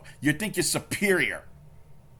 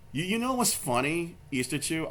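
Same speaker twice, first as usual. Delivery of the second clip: usual speaking voice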